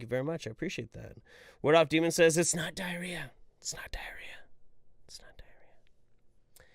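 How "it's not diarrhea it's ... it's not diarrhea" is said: each repeat quieter until whispered; fading to whisper. Shown as a demonstration. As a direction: let it fade into a whisper.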